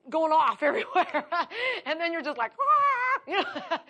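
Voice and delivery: chuckling through the words; amused laughter; laughing. Real, not acted. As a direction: laugh at the silliness. Laughing at own example.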